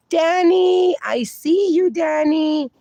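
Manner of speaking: high, drawn-out name call; playful calling out. Real, not acted. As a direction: call out to a friend you just spotted. Calling out viewer spotted in chat.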